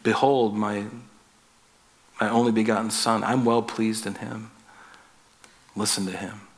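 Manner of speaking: imagine God speaking tenderly to his Son. Pretend. A preacher imagining God the Father speaking tenderly to the disciples, hushed and gentle. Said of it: "I'm sure it was very tender".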